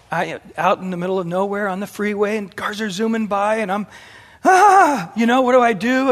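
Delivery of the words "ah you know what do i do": high, loud panicky cry; mock panic at a stuck tire; freaked out. Stranded driver panicking at roadside, loud and high. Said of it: "I was freaked".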